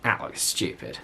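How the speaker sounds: low, breathy whisper of a scoff. Retold or imitated. Seeing a silly book cover in a shop, a whispered scoff. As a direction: mutter it under your breath, unimpressed. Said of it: "and I went".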